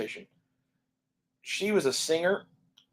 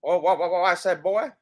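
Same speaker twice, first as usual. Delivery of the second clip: Foghorn Leghorn; 4 semitones higher, 3 dB louder than usual. Shown as a demonstration. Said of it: "I can do foghorn leghorn".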